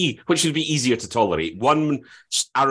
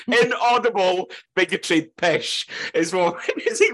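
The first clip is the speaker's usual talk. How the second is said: laughing; 6 semitones higher, slower than usual. Real, not acted. Joking mock caption, laughing.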